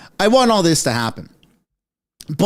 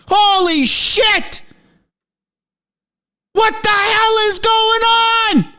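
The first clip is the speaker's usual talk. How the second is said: screaming; 14 semitones higher, slower than usual. Real, not acted. Streamer yelling at chat about Trudeau resigning, real screaming. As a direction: scream the breaking news in disbelief. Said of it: "Sorry for screaming".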